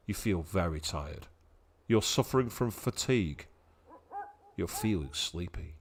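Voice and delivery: very soft, low, drowsy read; lulling, low-voiced tiredness; sleepy hush. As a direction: drop low and soft as if nodding off. Voicing sleepy game status messages.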